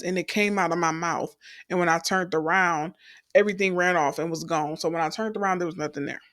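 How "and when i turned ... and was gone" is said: usual speaking voice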